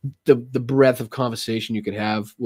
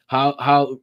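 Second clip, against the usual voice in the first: ordinary talk, nothing marked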